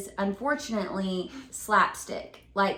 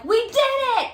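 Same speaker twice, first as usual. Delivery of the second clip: high loud cheer; triumphant; 13 semitones higher, 7 dB louder, much faster than usual. Retold or imitated. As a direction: cheer like you won. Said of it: "I'm like".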